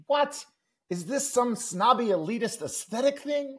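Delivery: high-pitched cartoon indignation; hammed-up furious kid, voice shooting up; mock fury. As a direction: sputter it in outraged disbelief. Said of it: "And Calvin is furious".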